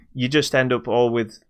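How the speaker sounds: ordinary delivery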